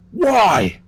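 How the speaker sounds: loud high shout of why; yelling. Acted. Judy yelling at her husband, a loud shout. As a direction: yell it at him. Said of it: "Judy then started yelling at him".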